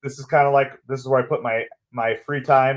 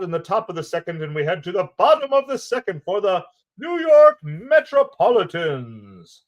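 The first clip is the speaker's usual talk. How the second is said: 1940s announcer; 9 semitones higher, 4 dB louder than usual. Pretend. Streamer calling a pickoff throw in 1940s announcer voice. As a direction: savor each word like old radio. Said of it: "forties announcer with some giggities".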